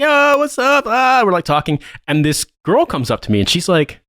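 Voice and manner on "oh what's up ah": high-pitched party holler; rowdy greeting. Retold or imitated. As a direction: imitate drunk revellers hailing each other. Retold drunk strangers greeting each other.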